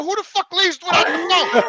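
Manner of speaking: loud, high indignant rant; angry rant. Retold or imitated. Retelling how he yelled at George's son, angry and loud. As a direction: blast the son for leaving the phone.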